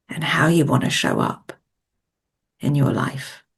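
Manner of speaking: measured coaching meditation voice with long pauses; guided visualisation. Acted. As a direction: guide them gently inward, phrase by phrase, leaving space to breathe.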